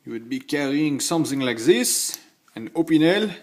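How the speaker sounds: hammed-up French accent, rising at the end. Knife reviewer playing someone in France, mock French accent. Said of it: "if you're in France".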